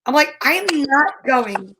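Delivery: ordinary talk, nothing marked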